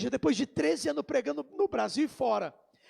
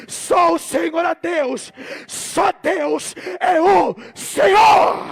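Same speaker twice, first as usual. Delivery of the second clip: slow, stretched shout rising to a climax; full-force preaching; 3 semitones higher, 12 dB louder, much slower than usual. Real, not acted. Believers giving glory that only God is Lord, drawn-out preaching shout. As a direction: draw out each word in a huge cry.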